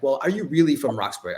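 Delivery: normal talking voice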